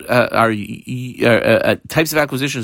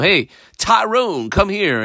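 higher, slow call across the room; slow, higher hail to come over; 8 semitones higher, slower than usual. Retold or imitated. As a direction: call him over from a distance. Teacher voices calling a slave.

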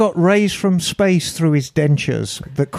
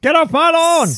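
shouting at trespasser; 10 semitones higher than usual. Pretend. Cranky neighbour yelling at kids.

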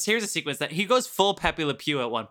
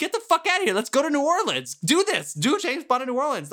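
high, louder ranting outburst; exasperated rant; 11 semitones higher, 3 dB louder than usual. Real, not acted. Ranting at the Bond filmmakers, exasperated high outburst. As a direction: rail at the filmmakers, pitch up and loud.

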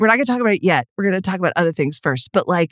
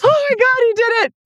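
thrilled squeal; 4 dB louder than usual. Real, not acted. Delighted at co-host's accent.

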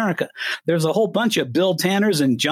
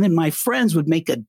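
ordinary delivery

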